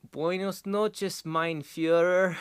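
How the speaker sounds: high, slow mock German greeting; mock German accent. Cyclist greeting the old man as he rides past, slow, high mock German accent. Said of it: "he's like".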